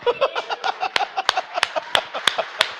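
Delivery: risada aguda e sem voz; risada soprada. Real, not acted. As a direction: ria em sopro, satisfeito. Laughs after his point. (pregador rindo baixinho da própria conclusão)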